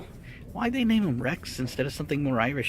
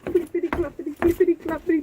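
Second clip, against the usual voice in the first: silly singsong; 14 semitones higher, 5 dB louder than usual. Pretend. Silly sing-song bit.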